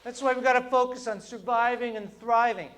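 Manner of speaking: usual speaking voice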